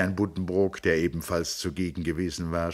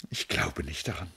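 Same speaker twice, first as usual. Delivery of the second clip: weeping; 4 dB quieter than usual. Acted. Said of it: "Sie schüttelte weinend den Kopf".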